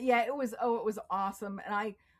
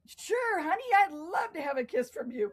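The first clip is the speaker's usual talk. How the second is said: a lightly voiced gallant old man, higher; old charmer; 7 semitones higher, 5 dB louder than usual. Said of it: "he goes".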